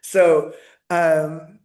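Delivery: usual speaking voice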